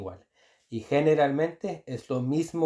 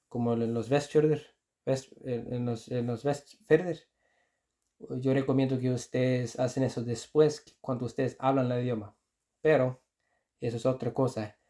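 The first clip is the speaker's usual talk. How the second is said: usual speaking voice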